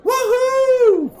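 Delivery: loud, very high held "woohoo"; mocking cheer. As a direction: imitate an over-excited fan's whooping. Imitating the wife's cheering.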